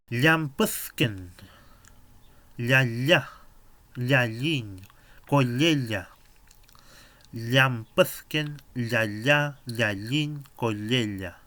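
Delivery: Llampuzken drawn out very slowly, ll sounds stressed and loud; each syllable of Llampuzken stretched out loud and clear; Mapuche ll sound. Shown as a demonstration. Teacher modeling the ll sound for beginners, slow and loud. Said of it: "el sonido es ll".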